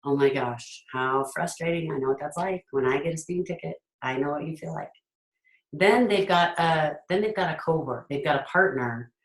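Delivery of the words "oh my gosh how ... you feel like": softer, gentle commiserating; a soft, understanding parent soothing a frustrated kid; empathetic parent. Retold or imitated. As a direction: sympathize like you've been there too. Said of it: "But now I can go".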